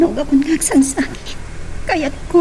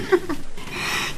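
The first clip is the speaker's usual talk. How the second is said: light breathy laugh; 3 semitones higher, 9 dB quieter than usual. Acted. Lisbeth greeting Onesto flirtatiously.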